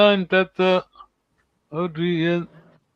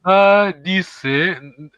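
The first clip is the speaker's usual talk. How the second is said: Kunsadise drawn out very slowly, louder, rising at the end; slow stressed demo; 7 dB louder, much slower than usual. Shown as a demonstration. Teacher answering a student's stress question, slow careful model. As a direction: spell out the stress slowly for a learner. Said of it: "Accent is in, in D".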